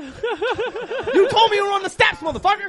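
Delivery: high, slow, loud laughing complaint; laughing outrage. Retold or imitated. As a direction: protest through laughter, betrayed. Retold own outraged complaint.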